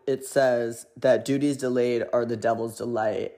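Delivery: ordinary talk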